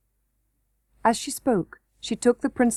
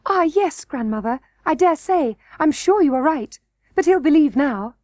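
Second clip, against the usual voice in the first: put-on higher, earnest girl's voice; eager princess; 5 semitones higher, 4 dB louder than usual. A narrator's reading. Princess assuring her grandmother, higher and earnest. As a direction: insist brightly like a little princess. Irene pleading.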